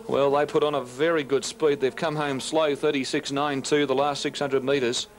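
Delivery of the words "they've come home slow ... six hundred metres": normal talking voice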